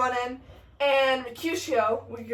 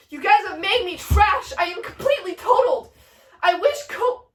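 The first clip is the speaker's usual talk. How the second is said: high, loud melodramatic complaint; hammed-up dying rant; dying Mercutio's outburst; 5 semitones higher, 5 dB louder than usual. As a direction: rail at them as you die. Dying Mercutio curses friends.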